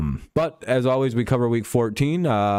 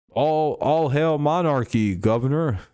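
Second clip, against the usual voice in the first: slow, higher fake British accent; mock British accent; 6 semitones higher, slower than usual. Shown as a demonstration. Streamer showing off his British guy accent, badly faked. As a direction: draw out a hammed-up British accent. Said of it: "How's that for a British guy accent?".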